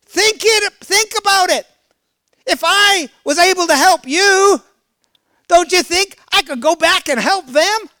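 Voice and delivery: high, loud, pleading voice of God; voice of the Lord. Acted. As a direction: speak as God urging his people to think. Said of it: "The Lord says".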